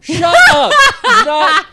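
high, loud snap of Shut up; loud outburst. Real, not acted. Reacting to the price tags, loud mock outrage.

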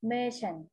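"merchant" said alone as "MAY-sen", falling at the end; English word model. Shown as a demonstration. Teacher reads out the English word for the children, "merchant" modelled with a falling tone. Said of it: "ภาษาอังกฤษอ่านว่า".

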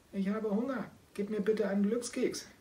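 high, pleading little cat voice; kitten voice. Acted. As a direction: beg like a hungry kitten. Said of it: "meldete sich Kasimir zu Wort". (hohe bittende Kätzchenstimme)

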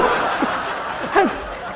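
crowd laughs at the punchline; audience laughter. Real, not acted. Comic tells the crowd a joke, laughter. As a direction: room bursts into laughter.

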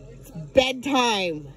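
drawn out, stern and slow; slow firm bedtime. Real, not acted. Owner to dogs at bedtime, slow and stern. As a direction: lay down the law slowly.

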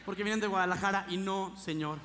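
ordinary talk, nothing marked

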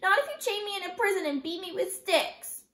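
put-on high, loud, defiant child; sputtering protest. A narrator's reading. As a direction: refuse stubbornly, stretching the words. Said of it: "Kirsty sputtered".

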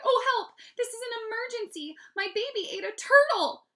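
high, loud panicked plea; crying out. A narrator's reading. Mom phones the operator about her baby, crying out. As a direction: cry for help in hysterics.

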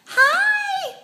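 very high, loud squeal; squealed pet greeting. Real, not acted. Owner greeting Luca the cat, high-pitched squealed hello.

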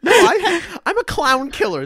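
ordinary talk

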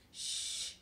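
soft, breath-only shush; hushing. A narrator's reading. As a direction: hush the noisy pirates. Child hushes the loud pirates.

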